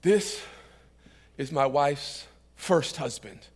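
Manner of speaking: slow, low reveal with long pauses; composed, deadpan punchline. Acted. Mourner finally explains his tears, composed and deadpan. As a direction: hold back, then drop the punchline quietly. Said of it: "composes himself, cleans himself up".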